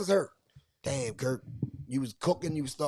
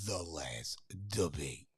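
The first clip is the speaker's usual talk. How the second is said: very slow, low, hushed announcer delivery; drawn-out low title announcement; segment announcement; 10 semitones lower, 9 dB quieter, much slower than usual. Real, not acted. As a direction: announce it slowly, deep and soft. Announcing the show's next segment.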